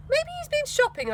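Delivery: a cheeky guess; Trev's voice. Acted.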